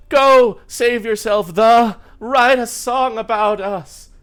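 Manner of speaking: high, loud, drawn-out melodrama; hammed-up parting plea; cleric lady. Cleric sends the lute player away, melodramatic cry.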